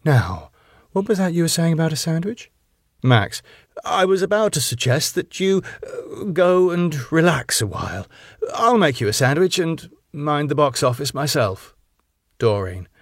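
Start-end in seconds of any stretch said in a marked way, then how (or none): 0.1-2.5 smiling sweetness. A narrator's reading. Wife prompts cowed husband for his sandwich, sweetly. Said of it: "then looks up smiling". 3.8-11.8 high, hesitant offer; nervous placating. A narrator's reading. Husband offers wife a sandwich, nervously placating.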